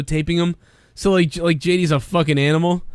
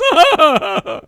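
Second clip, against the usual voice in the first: loud squealing laugh; high laugh; 13 semitones higher, 5 dB louder than usual. Real, not acted. Laugh after drunk quote.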